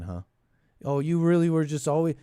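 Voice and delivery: ordinary talk, nothing marked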